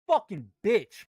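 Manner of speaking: loud snarl at the screen; angry curse. Real, not acted. Trader cursing the market, loud angry outburst. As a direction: spit the curse out.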